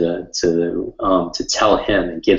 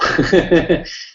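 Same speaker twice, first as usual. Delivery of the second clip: burst of laughter; 10 semitones higher than usual. Real, not acted. Laughing at his own excitement.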